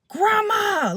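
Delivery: high, drawn-out call for grandma; retold scream. At my altar calling on grandma, imitated scream. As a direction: cry out, imitating a desperate scream. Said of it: "like, screaming".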